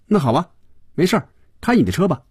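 high, quick, offhand dismissal; breezy young character. A narrator's reading. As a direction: wave the driver off in a high, brisk voice. 菲比轻快地打发司机. (轻快地打发人，声调高、语速快)

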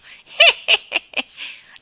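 high, loud heh-heh cackle; clucking old man's cackle. Acted. Great-grandfather's laugh echoing through the room, cackling. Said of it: "La risata chioccia del bisnonno".

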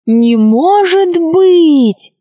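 high, drawn-out mock outrage; queen's theatrical fake anger; feigned indignation. A narrator's reading. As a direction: pretend to be cross, stretching the words out high. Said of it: "притворно рассердилась королева".

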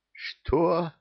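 high, startled gasp. A narrator's reading. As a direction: gasp it out in shock. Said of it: "ахнул кто-то".

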